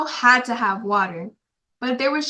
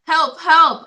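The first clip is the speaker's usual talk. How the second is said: higher, louder mock call for help; cry for help; 6 semitones higher, 5 dB louder than usual. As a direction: call out help like a boy in trouble. Child reads character's cry for help.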